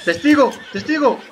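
very loud, high call-out; high, urgent yell, falling at the end; alarm shout. Real, not acted. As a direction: shout the alert across the room. Calls out a witness in game.

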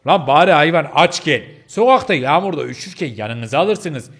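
exasperated telling-off; indignant scolding. Acted. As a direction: tear into them in outrage. Listener angrily scolds the teller.

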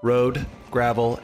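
usual speaking voice

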